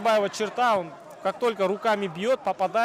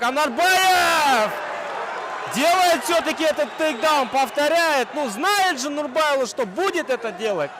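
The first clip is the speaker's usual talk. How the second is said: raised, higher play-by-play; excited commentator; 5 semitones higher than usual. Real, not acted. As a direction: call the takedown with energy. Live takedown call.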